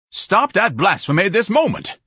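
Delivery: high, outraged yell; scandalized outburst, pitch swooping high; screamed command. Acted. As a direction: scream it in pompous indignation. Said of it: "Ignatius screamed".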